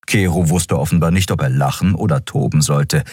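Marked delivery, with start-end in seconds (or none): none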